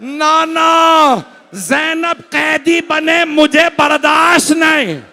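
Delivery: high, loud wail with long-held words. Retold or imitated. Hussain protesting his sister's captivity to grandfather, anguished wail. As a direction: break into a full-force cry of grief. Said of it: "मौला रोने लगे".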